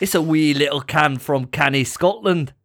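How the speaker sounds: playful fake Scottish accent. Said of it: "Say, like".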